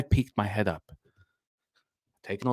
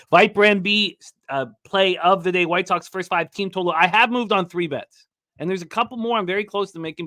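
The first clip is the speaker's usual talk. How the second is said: hyped bet read; 6 semitones higher than usual. Acted. Host reading a viewer's parlay, hyped announcer voice. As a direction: sell each parlay like a ring announcer.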